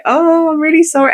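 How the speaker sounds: put-on embarrassed drunken sorry, voice rising high; tearful apology. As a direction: squeak out the apology as if about to cry. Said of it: "And then I started crying".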